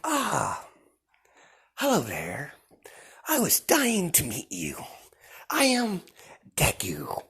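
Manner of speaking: high, drawn-out sinister welcome; sly villain greeting. Acted. Deku greeting the frightened villains, high and sinister. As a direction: greet them like a smug predator.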